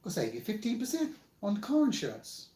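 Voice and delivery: high, chirpy ad mascot voice; Geico gecko voice. Retold or imitated. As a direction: sell insurance like the gecko. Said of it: "made him sound like the Geico gecko".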